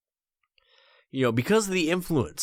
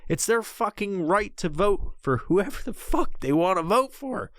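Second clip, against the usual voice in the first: ordinary talk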